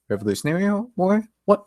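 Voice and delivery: high, quick rising question; incredulous echo. Retold or imitated. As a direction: echo it back in acted disbelief. Rip echoes unknown war, puzzled.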